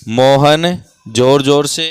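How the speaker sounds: loud, drawn-out prompt of Mohan जोर जोर से; slow loud prompt. Shown as a demonstration. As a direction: stretch the words to prompt the pupil. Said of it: "teacher prompts the sentence". (ज़ोर से खींचकर बोलना)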